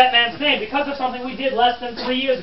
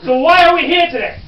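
loud, high rallying question; emphatic prodding; 6 semitones higher, 9 dB louder than usual. Pretend. Guide quizzing his audience on the occasion, loud and insistent. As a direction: throw the question out to the crowd.

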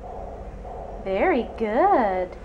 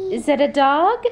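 ordinary talk, nothing marked

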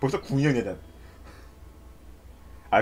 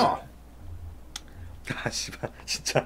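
cursing under breath; 10 dB quieter, much slower than usual. Real, not acted. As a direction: swear softly to yourself. Frustrated curse after mistake.

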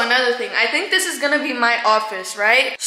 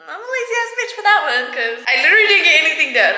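whiny laughing; 4 semitones higher, 3 dB louder, faster than usual. Real, not acted. Self-mocking whiny outburst.

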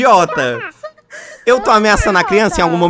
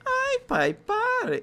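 much higher and softer, mock little girl voice; 9 dB quieter, much slower than usual. Player mocks the girl he kicked, high teasing girl voice. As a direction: parrot the kid's cry back, teasing. Mocking the girl's cry afterwards.

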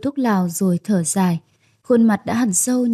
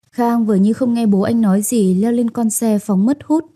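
ordinary delivery

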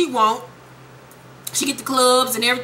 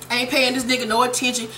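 ordinary delivery

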